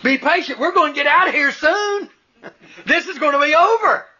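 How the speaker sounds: a man talking himself down, amused; self-soothing father. Retold or imitated. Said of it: "He was trying to talk to himself".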